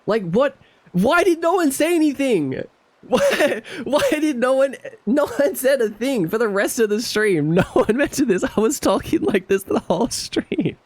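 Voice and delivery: high, squeaky laughing outrage; can't believe nobody told you; giggling exasperation; laughing disbelief. Real, not acted. Nobody told him about voice.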